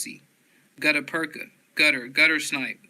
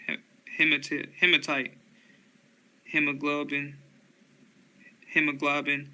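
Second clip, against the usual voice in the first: ordinary talk, nothing marked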